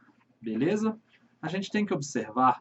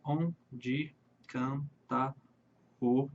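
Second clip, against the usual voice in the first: very slow and soft, syllable by syllable; slow scansion; 2 semitones lower, 5 dB quieter, much slower than usual. Shown as a demonstration. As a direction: draw each syllable out softly. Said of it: "Vamos pro versinho de baixo".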